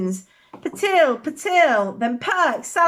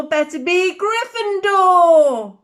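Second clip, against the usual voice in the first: slow, higher and louder shout; hat's shout; 6 semitones higher, 6 dB louder, much slower than usual. Acted. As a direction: call it out slowly to the whole hall. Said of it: "hat shout the last word".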